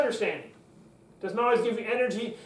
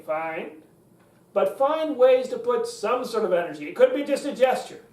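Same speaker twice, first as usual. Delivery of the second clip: normal talking voice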